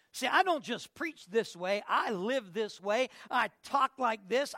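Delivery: ordinary delivery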